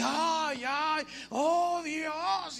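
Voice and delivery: slow, high drawn-out fake wail; fake crying. Stranger at the coffin crying falsely, slow high wail. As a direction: stretch the wail out like staged grief. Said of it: "y como que lloraba".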